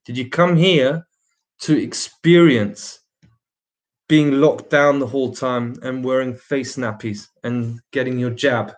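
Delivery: ordinary talk, nothing marked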